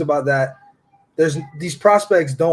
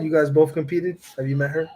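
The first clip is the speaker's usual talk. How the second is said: normal talking voice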